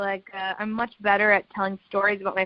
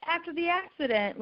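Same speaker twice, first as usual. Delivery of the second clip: said again louder and clearer for someone who missed it; louder repeat after "what was that"; 8 semitones higher, 5 dB quieter than usual. Real, not acted. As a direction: repeat it louder so they catch it. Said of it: "Do what was that?".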